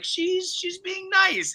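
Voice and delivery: very high, slow falsetto innocence; naive king. Retold or imitated. The king defending the princess, in a very high, slow falsetto. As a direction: float it up high like a clueless old man. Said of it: "the king's like".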